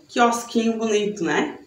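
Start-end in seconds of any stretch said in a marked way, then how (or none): none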